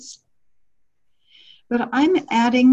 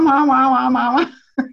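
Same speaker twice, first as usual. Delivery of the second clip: Charlie Brown teacher wah wah; muffled trombone-like wah wah, higher and louder; muffled wah wah talk; 4 semitones higher, 4 dB louder, slower than usual. Retold or imitated. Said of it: "sounds like, wah".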